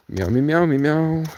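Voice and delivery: sing-song meows; meowing. Pretend. Playfully meowing at the cats. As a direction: meow like a cat.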